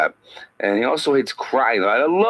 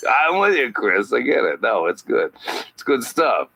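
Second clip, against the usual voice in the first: ordinary talk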